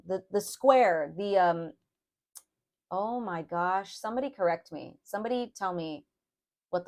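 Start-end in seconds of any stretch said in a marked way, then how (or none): none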